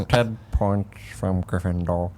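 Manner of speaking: a hint of a sneering professor; Snape impression. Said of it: "that was some gargling shit".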